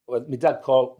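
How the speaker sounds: ordinary talk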